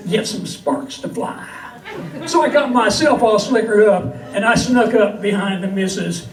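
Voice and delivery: lively, lilting comic verse for a crowd; cowboy poetry recital. Acted. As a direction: tell it as a rhyming yarn from the stage. Recites comic cowboy poem to audience.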